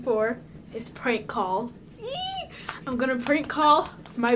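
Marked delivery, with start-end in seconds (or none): 2.0-2.5 very high, soft squeal; thin high "eee" of anticipation; excited squeal. Real, not acted. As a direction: let out a tiny squeak of glee. Excited about the prank call.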